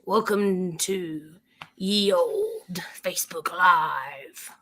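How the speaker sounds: slow, low, drawn-out pirate greeting. Acted. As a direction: drag it out like an old sea captain. Slow mock old-timey intro.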